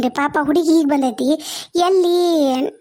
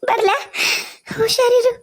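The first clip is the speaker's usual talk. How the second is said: higher, laughing and crying at once; tearful goodbye; 5 semitones higher than usual. Acted. Mother's final farewell to daughter, tearful. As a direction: say goodbye while fighting tears.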